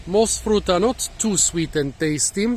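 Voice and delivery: normal talking voice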